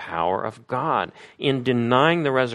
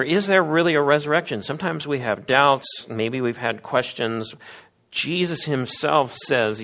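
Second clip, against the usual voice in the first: usual speaking voice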